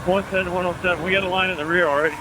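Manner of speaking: loud, fast radio shout; shouting over the noise of the fire; yelling over fire hose. Real, not acted. Crew radioing from fire scene.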